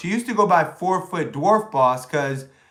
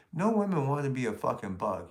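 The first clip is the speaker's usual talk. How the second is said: usual speaking voice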